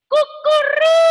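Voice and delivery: high, drawn-out rooster crow; high pretend cock-a-doodle. Shown as a demonstration. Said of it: "A cock does what?".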